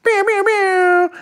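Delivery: high, slow, hammed-up meowing; mock cat meow. Silly meows at the dog.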